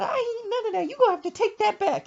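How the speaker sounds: high-pitched refusing mother; mama voice. Retold or imitated. Mother rejecting son's shopping, high indignant mock voice. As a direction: put on a shrieky high mama voice. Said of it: "She said".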